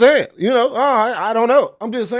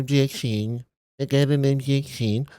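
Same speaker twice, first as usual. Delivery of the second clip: softer, meek little objection; mocking the timid lawyer's weak objection, softer; weenie objection; 4 semitones lower, 6 dB quieter than usual. Lawyer mocked for objecting with no bass, meek and softer. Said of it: "can't sound like a little weenie dog".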